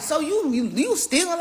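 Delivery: high-pitched accusing question; accusing his fiancée of theft, high and heated; angry accusation. Retold or imitated. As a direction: call her out in disbelief. Accusing her of stealing.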